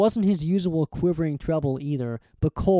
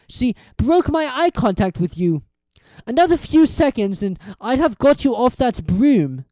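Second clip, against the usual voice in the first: gloating villain; 7 semitones higher, 4 dB louder than usual. Acted. Quirrell gloating.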